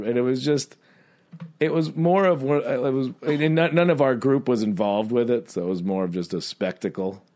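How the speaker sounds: ordinary delivery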